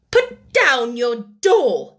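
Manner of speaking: loud, slow, emphatic order; stern command. A narrator's reading. Miss Minchin orders a child to put the doll down, sternly. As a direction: order it firmly, word by heavy word.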